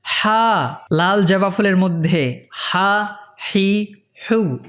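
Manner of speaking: ordinary delivery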